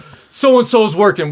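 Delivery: high, loud, drawn-out alert; warning call. Retold or imitated. Inmate alerting others the guard is coming, loud call. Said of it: "they'd be like".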